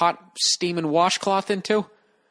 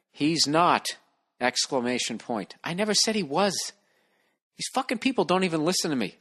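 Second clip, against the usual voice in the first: normal talking voice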